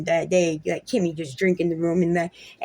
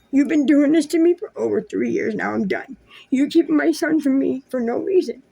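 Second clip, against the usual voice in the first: louder, higher, heated complaint; raised angry voice; 6 semitones higher, 4 dB louder than usual. Real, not acted. Venting to the ex about being kept from the son, louder and heated. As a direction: push it out in frustration.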